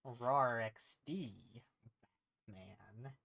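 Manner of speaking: a lightly voiced Joker, savouring it; slow mock-villain rawr; drawn-out Joker growl. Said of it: "something he'd say".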